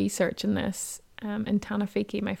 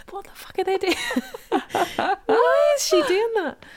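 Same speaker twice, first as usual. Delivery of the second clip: high, slow bewildered mock voice, rising louder; baffled onlooker voice; 13 semitones higher, 4 dB louder, slower than usual. Acquaintance imagined staring at her turkey videos, baffled mock voice. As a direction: play the confused stranger staring at the laptop. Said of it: "he must have been like".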